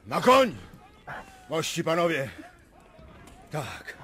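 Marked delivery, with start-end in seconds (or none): 0.1-2.3 high, loud order to mount; rally the troops; loud cavalry order; battle command. Acted.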